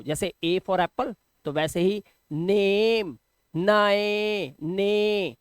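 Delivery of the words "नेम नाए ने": नेम, नाए, ने with the "ay" vowel drawn out long and level; long A sound. Shown as a demonstration. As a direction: stretch नेम, नाए, ने slowly, holding each vowel. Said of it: "इसको वैसा ही pronounce करो". (नेम, नाए, ने में "ए" को लंबा खींचकर)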